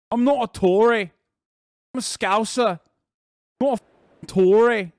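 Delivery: high, loud mock Scouser; Liverpool accent. Streamer attempting Liverpool on request, indignant Scouse accent. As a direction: protest loudly in a put-on Scouse accent. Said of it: "Liverpool accent".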